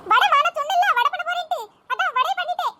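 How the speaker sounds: ordinary delivery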